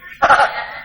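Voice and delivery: brief high chuckle; quick amused laugh; short laugh. Real, not acted. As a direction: chuckle at your own blunt remark. Laughs after teasing the listeners.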